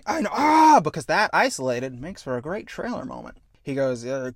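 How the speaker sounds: usual speaking voice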